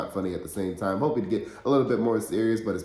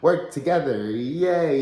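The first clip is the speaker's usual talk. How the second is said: sarcastic yay; 6 semitones higher, 6 dB louder, much slower than usual. Acted. Mock cheer about rivals teaming.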